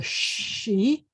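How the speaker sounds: she with a long drawn-out sh; sounded out. Shown as a demonstration. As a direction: stretch she as one separate syllable. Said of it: "can you sound it out?".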